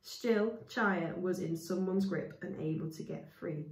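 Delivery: usual speaking voice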